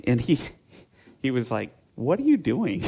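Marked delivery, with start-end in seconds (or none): 1.9-2.8 higher-pitched incredulous question; retold bewildered brother; baffled challenge. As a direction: question it in mock disbelief. Brother challenges the put-on accent.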